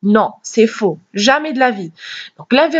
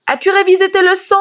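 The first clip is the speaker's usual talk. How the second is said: higher and fast, rising on révisé; teacher showing a question tune; question intonation; 7 semitones higher, faster than usual. Shown as a demonstration. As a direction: ask As-tu révisé tes leçons? with a lifted tune. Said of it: "c'est la manière avec laquelle je prononce".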